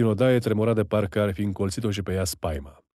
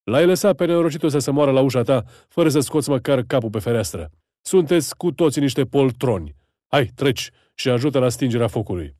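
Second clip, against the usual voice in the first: higher, louder contemptuous rebuke; scolding gendarme; 4 semitones higher, 4 dB louder than usual. Acted. Gendarme berating the townsman for hiding, loud angry scolding. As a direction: tear into the coward townsman. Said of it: "Un jandarm îl luă la rost".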